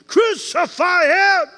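high, loud, slow shout; shouted crowd cry. Retold or imitated. The crowd shouting at Jesus, high and loud. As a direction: yell it like an angry mob.

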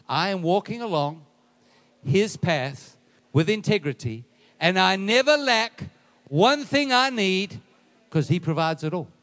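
loud preaching, rising to a high peak; congregational declaration. Acted. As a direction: proclaim it in firm, separated phrases.

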